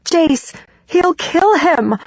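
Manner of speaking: high, slow desperate cry. A narrator's reading. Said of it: "I cry out".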